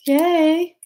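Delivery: loud, high cheer of yay; excited yay. Retold or imitated. Choosing the dragon coins, a high loud yay. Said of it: "So I said".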